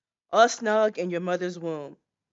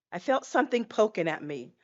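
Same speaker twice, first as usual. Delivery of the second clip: ordinary talk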